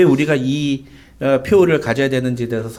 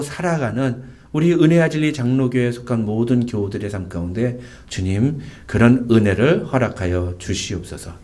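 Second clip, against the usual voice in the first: prayer; 5 semitones lower than usual. Acted. Closing prayer after sermon.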